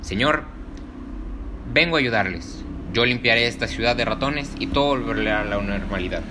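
serious. Acted. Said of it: "con un gesto serio".

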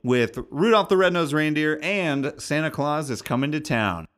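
upbeat show-intro announcer. Acted. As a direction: read it like a radio promo. Podcast intro announcer read.

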